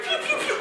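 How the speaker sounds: very high, soft laughing pew pews; gun sound effect. Shown as a demonstration. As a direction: squeak out tiny laser shots. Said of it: "it goes something like".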